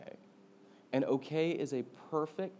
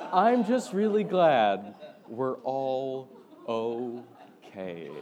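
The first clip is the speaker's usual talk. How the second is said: slow drawn-out quote; 7 dB louder, much slower than usual. Retold or imitated. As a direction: stretch every word out slowly and louder. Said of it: "and I quote".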